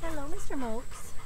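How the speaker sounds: pet talk. Real, not acted. Owner softly greeting her dog Mocha with sweet pet talk. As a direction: coo hello to the pup.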